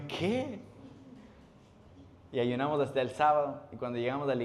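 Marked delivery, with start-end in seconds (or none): 0.1-0.6 high incredulous "¿Qué?"; shocked what. Retold or imitated. Young volunteer told to keep fasting, high disbelief. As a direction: react as if you can't believe it.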